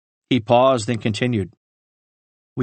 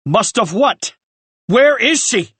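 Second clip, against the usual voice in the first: rising to a loud, higher shout; shouted demand; 7 semitones higher, 4 dB louder than usual. A narrator's reading. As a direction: bark it at him, demanding an answer. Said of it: "Ryan shouted".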